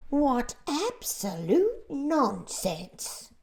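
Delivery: loud, very slow indignant cry; earthworm scoffing. A narrator's reading. The earthworm rejects the grasshopper's comfort, scornful. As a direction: scorn it as pure nonsense. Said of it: "cried the earthworm".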